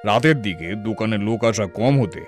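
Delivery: plain reading voice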